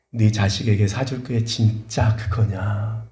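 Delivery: low, slow, softened questioning; the Lord. Retold or imitated. The Lord challenges a lamenting parent, low and slow. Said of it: "주님이 물으시는".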